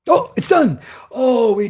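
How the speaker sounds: real excited yell at the end of the debate; excited shout. Real, not acted. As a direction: blurt it out as the debate finally ends. Debate ends on stream.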